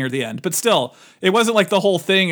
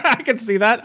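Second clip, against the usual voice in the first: laughing agreement, pitched up; amused; 4 semitones higher than usual. Real, not acted. Amused by the actor's impression, laughing. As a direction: say it through a laugh.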